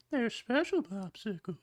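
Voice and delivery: put-on very high old-man voice; creepy old man in a thin falsetto; soft, high-pitched creep impression; creepy old Herbert. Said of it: "ends up hearing Herbert say".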